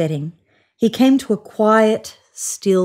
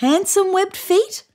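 high, slow hopeful call; 12 semitones higher than usual. A narrator's reading. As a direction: call out still hoping. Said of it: "called the bunyip".

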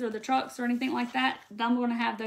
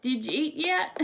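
Southern "Did you eat yet?", run together casually; playful demo of Southern speech; Southern talk; 3 semitones higher than usual. Shown as a demonstration. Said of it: "that is the way we talk".

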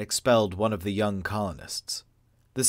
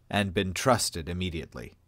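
ordinary delivery

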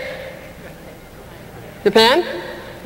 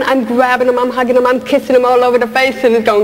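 ordinary talk

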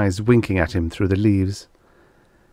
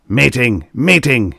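loud, raised, emphatic calls; crying out; 4 semitones higher, 5 dB louder than usual. A narrator's reading. Angry producer calls his staff, crying out. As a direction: cry it out like an order. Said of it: "he cried".

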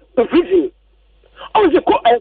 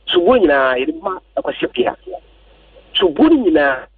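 ordinary delivery

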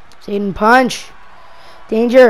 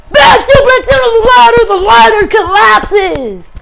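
high loud yell that slows as the ladder goes; hyped play-by-play yell; excited shout; 7 semitones higher, 6 dB louder than usual. Real, not acted. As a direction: shout the spot, then drag out the collapse. Commentator yells at big move.

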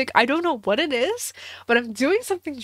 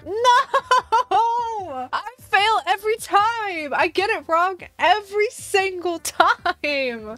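high, despairing wail of defeat; a real, high-pitched frustrated howl; anguished cry; 9 semitones higher, much slower than usual. Real, not acted. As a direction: cry out that you always lose. Wrong villager guess, despair.

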